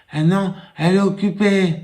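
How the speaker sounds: put-on high little boy voice. Small boy telling caller everyone is busy, high child voice. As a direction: answer like a small child, matter-of-fact.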